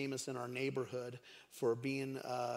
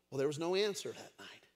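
ordinary delivery

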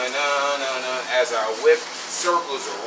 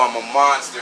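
ordinary talk, nothing marked

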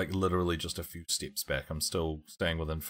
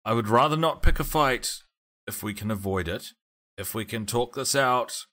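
raised, louder voice to an opponent; in-character appeal; 5 semitones higher, 6 dB louder than usual. Acted. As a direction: call out a higher-pitched plea to talk it out. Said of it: "I'm going to say".

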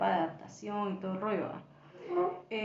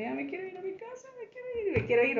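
imitated tearful whining; 10 semitones higher, faster than usual. Guest re-enacting her homesick midnight drama, mock tearful. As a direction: whine it quickly, as if crying to go home. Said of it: "llorando horrible".

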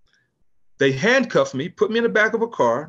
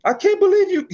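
much higher, louder disbelieving yell; incredulous outburst; 14 semitones higher, 4 dB louder than usual. Retold or imitated. Chief yelling at his officers, mock incredulous. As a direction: imitate a boss who can't believe it. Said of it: "He was like".

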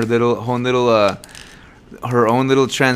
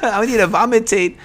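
imitating sister; 8 semitones higher than usual. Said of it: "That's what she said".

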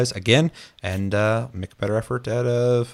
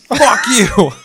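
mock shout; 12 semitones higher, 10 dB louder than usual. Hammed retort continued.

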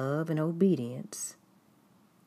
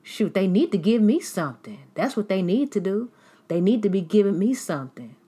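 loud and higher, pushy and grasping; mocking a greedy critic; 5 semitones higher, 8 dB louder than usual. Hypocrite tells the giver to hand it over, loud mocking. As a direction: needle it like a jealous hypocrite wanting the money. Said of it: "And they're telling you".

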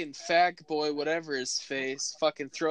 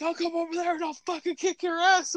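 much higher, mocking threat; 12 semitones higher, faster than usual. Teller mimics neighbor threatening, high mocking voice. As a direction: squeak out the threat in falsetto. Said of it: "she's like".

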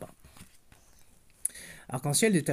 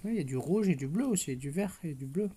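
put-on gentle storybook narration for a toddler; picture-book reading voice; bedtime story reading; 4 semitones higher than usual. A narrator's reading. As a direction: read it like a bedtime tale to a little girl. Reads bedtime story to child.

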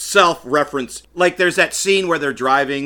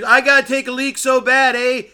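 mock Canadian; 8 semitones higher than usual. Quoting Rick Moranis character.

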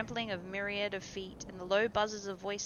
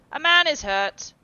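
much higher, loud alarm; shouting; 8 semitones higher, 13 dB louder than usual. A narrator's reading. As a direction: call out for help. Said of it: "he called at the top of his voice".

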